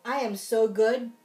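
plain reading voice

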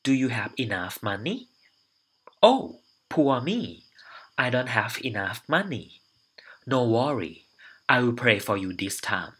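very slow, deliberately clear English read-aloud; slow dictation reading. Shown as a demonstration. Teacher reading the exam dialogue slowly, clearly. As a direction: spell out each word so students can follow.

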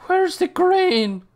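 drawn-out high holler; loud, high, over-dramatic plea; mock desperate shout. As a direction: demand it in a hammed-up yell. Mock demand after friend's grain remark.

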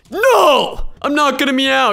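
loud, high No; protesting loudly against meowing; mock refusal. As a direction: bark a refusal. Refusing to meow in game.

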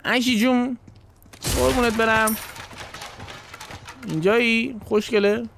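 high teasing call to Ashley. Real, not acted. Streamer calling out to game character Ashley. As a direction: call out sweetly across the room.

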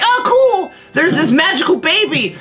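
excited believers. Acted. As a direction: gush like a dazzled fan of the baby. Crowd voiced in story.

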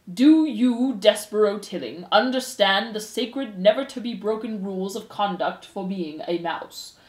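slow, pompous, formal pronouncement; stern head mouse. Acted. Head mouse puts rumors to the accused, stern and formal. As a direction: preside with weighty, deliberate authority.

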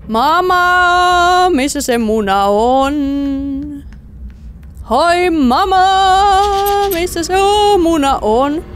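high, long-held call with stretched vowels; singsong calling out. Pretend. Player calls to Mama asking for the egg, drawn-out calling. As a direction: call out as if to someone rooms away.

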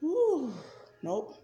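ordinary talk

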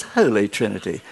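a light send-up of posh English "Holy Trinity"; mock posh English accent. Preacher mocking Etonian church staff, light posh English accent. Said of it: "they're all Etonians with their posh accents".

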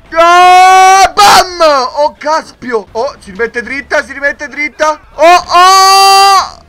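high, loud frantic yells; frantic shouting. Real, not acted. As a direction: yell in frantic surprise. Rhino charge, chaos. (grida frenetiche, alte e forti)

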